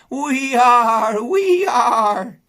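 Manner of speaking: loud, high bleating chorus; the flock agreeing with Ma. Acted. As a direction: sheep chanting agreement.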